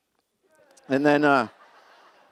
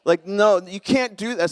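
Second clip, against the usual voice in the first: higher, louder mock whine of a husband crying foul; whiny protest; 7 semitones higher, 5 dB louder than usual. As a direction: play the aggrieved husband, half-joking. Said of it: "And I'm like".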